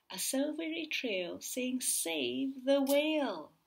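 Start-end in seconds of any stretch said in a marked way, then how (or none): 1.8-3.5 slow, louder, each word weighed; slow emphatic. A narrator's reading. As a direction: spell out "Save the whale" slowly. Trail spells out message.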